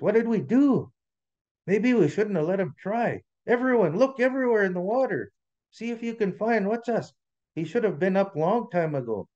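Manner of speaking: high, urgent, anxious call; alarmed storybook leader; worried. A narrator's reading. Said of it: "Even Trickster was worried".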